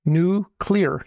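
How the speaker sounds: NUCLEAR split as nu, then clear; careful pronunciation. Shown as a demonstration. Correcting the other host, careful pronunciation. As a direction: spell out Nuclear by ear, syllable by syllable. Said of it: "how do you say it".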